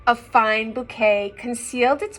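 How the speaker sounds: lilting storybook read-aloud; reading to children. A narrator's reading. Parent reading a picture book to children, lilting. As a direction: read the rhyme aloud to little ones.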